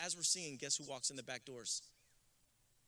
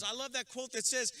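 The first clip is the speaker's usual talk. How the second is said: ordinary talk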